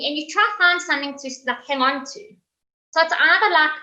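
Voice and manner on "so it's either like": ordinary talk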